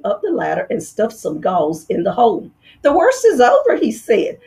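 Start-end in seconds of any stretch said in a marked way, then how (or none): 2.8-3.8 reassuring. Acted. Dentist reassuring the fox.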